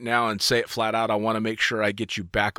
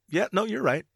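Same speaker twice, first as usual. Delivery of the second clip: a lightly voiced boss, higher and breezy; imitating the boss; 8 semitones higher than usual. Retelling to the boss how he gave in, lightly mimicked. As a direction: mimic the boss giving in easily. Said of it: "And you just went".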